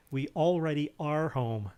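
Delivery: slow, deliberate "already are"; measured, carefully separated "We already are home"; careful retry. Shown as a demonstration. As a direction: say "already are" slowly, word by word. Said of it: "That's hard to say".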